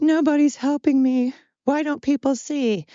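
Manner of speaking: high-pitched mock crying complaint; mock whine. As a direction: whimper it like a self-pitying martyr. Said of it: "I can't cry and whine".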